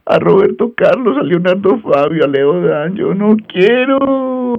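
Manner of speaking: high falsetto whining complaint; mock crying whine. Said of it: "Yo lloraba".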